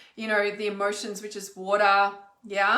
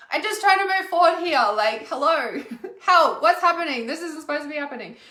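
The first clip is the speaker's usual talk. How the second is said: exasperated plea; 5 semitones higher, 5 dB louder than usual. Retold or imitated. Frustrated by appliances breaking down.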